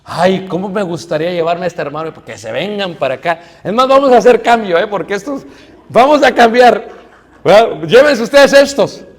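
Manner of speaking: hammed-up wish to trade brothers; envious churchgoer. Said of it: "vas a otra congregación y dices".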